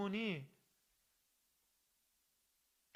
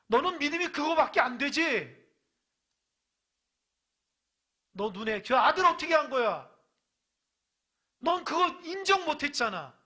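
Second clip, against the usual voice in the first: high, loud scolding as God; God scolding the faithless, loud and high; God's rebuke; 9 semitones higher, 12 dB louder than usual. Acted. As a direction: thunder down a rebuke on the doubter. God rebuking Sarah, voiced.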